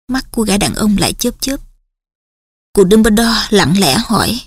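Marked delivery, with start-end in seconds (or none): none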